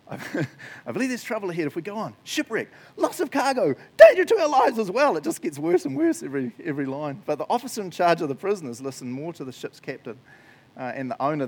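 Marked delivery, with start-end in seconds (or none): 0.1-5.2 rising higher and louder into an alarmed cry; dramatic reading. Shown as a demonstration. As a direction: read it like the warning is getting worse. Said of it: "I love this".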